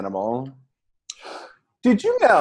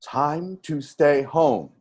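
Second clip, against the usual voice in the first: imitating automated voice; 3 semitones higher than usual. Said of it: "it's his automated voice".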